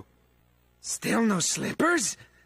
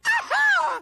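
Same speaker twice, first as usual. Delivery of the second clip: very high joyous cackle; villain cackling with delight; shrieking delighted laugh. Acted. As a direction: let out a shrill squeal of glee.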